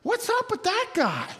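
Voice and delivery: high-pitched, incredulous guest; mock-baffled onlooker; puzzled party guest. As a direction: gawk at the odd one out. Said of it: "you're a guest, and you come walking by".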